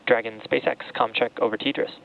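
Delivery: crackly radio comm check; spoken over a space radio loop; astronaut radio. Real, not acted.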